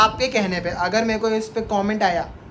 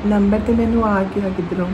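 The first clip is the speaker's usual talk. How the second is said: fake Harleen Kaur. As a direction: play a polite Punjabi woman calling a stranger. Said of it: "ना कि कोई और हरलीन कौर".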